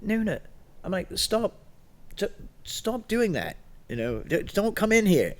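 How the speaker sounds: high urgent warning call. Retold or imitated. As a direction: warn him off in a high voice. Shouting warning at friend.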